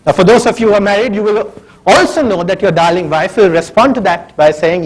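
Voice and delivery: ordinary talk, nothing marked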